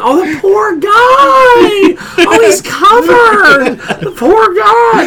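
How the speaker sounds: high, drawn-out pitying wail; hammed-up cry of sympathy, loud and stretched; Diana's pitying wail. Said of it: "the best is Diana going".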